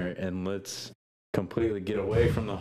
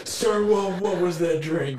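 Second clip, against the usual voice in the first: loud tipsy call; 10 semitones higher, 8 dB louder than usual. Acted. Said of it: "I'll be like".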